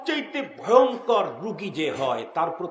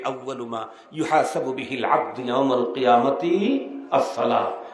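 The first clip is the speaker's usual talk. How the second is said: ordinary talk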